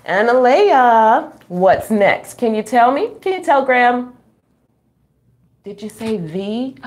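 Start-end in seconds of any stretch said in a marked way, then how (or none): none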